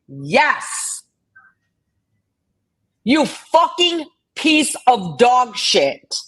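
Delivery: angry rant at an accuser in chat; furious confrontation. Real, not acted. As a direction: tear into her point by point. Confronting Southern Girl over theft claim.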